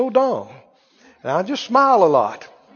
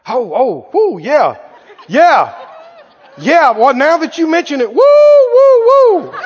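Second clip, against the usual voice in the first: put-on fervour building to high, loud whoops; mock overcome worshipper, escalating; faked spiritual excitement; 6 semitones higher, 6 dB louder than usual. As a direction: pretend to feel it, working up from "oh" to hollering. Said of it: "Trying to work something up".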